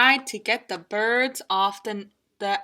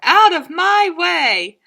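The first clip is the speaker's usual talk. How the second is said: cowboy goose exclaiming; 10 semitones higher, 9 dB louder than usual. Acted. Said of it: "cried the goose".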